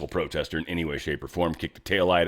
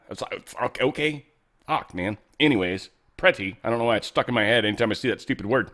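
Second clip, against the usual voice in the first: usual speaking voice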